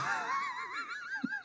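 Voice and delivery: high giggling fit; helpless laughter. Real, not acted. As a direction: crack up till the breath runs out. Cracking up at rant video.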